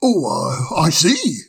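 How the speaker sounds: a genial owl putting the guest at ease; wise old owl. Acted. Old owl reassures the fire sprite, kindly.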